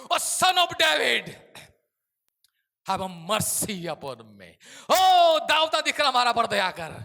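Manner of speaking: loud, high, drawn-out plea; crying for mercy. Acted. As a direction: beg Jesus for mercy at full voice. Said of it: "બૂમ પાડી".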